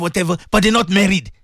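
ordinary talk, nothing marked

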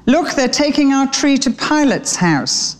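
a lightly voiced excited young sparrow; young sparrow Harrow. A narrator's reading. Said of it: "claimed Harrow".

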